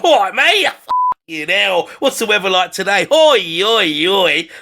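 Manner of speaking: hearty mock builder banter, oi shouted; blokey workman greeting. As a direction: call out to the tradesman like one of the lads. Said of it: "you're like".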